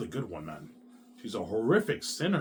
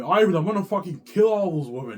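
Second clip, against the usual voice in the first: higher and louder, imitating his wife's furious threat; hammed-up angry wife ranting at her husband; mock wife's tantrum; 7 semitones higher, 7 dB louder than usual. Wife threatening other women to husband, mock angry yell. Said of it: "At night, she's crying to me".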